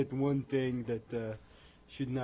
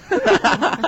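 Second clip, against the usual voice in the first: ordinary delivery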